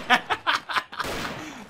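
long, high laugh; mocking laugh. Real, not acted. Gamer teasing teammate about level, high laughter.

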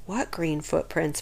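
put-on a boy whispering in a spooky hotel; whispered. A narrator's reading. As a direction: hush it, nervous in the dark. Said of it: "Bradley whispered".

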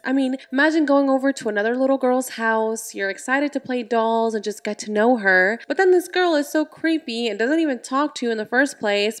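ordinary delivery, nothing marked

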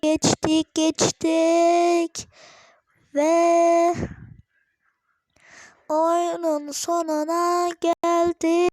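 high, loud, slow proclaiming; triumphant announcing. Real, not acted. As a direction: announce the finish grandly.

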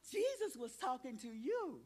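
sharp gasp, then a lively declaration; gasp then exclaim. Shown as a demonstration. Teacher showing children how to say it, gasping. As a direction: gasp as if struck by the news. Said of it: "go like this".

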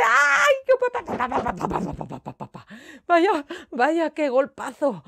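high yelp then stuttering bump; crash landing yelp. Pretend. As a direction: yelp as you hit the ground hard. Aterrizaje con golpe. (gritito por el golpazo)

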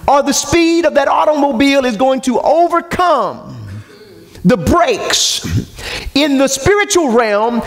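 high, driving sermon delivery; full-force preaching. Real, not acted. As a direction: preach it with rising intensity, pitch held high.

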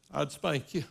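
put-on soft, low little girl saying sir; a guilty child answering her dad; meek daughter. Daughter answers her father's questions, meek and soft. Daughter voiced in retold story.

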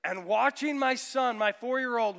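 ordinary talk, nothing marked

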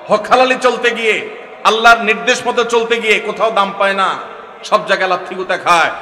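building from firm to a loud, high shout; preaching crescendo. Acted. Preacher rousing the congregation about the righteous, building to a shout.

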